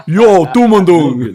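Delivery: loud mock New York shout; New York accent. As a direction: call it out like a hammed-up New Yorker. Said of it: "got the New York pronunciation".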